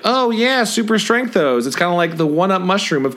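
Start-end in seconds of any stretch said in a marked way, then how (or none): 0.1-1.6 knowing fan voice. Retold or imitated. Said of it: "you're supposed to be like".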